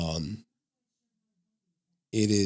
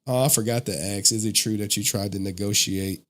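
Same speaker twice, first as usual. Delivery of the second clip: ordinary talk, nothing marked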